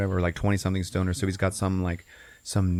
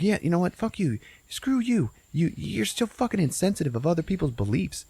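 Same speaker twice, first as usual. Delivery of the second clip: high-pitched mock offense; retold indignant comeback; 9 semitones higher than usual. Food rejecting his warning, indignant. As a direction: act out an affronted telling-off.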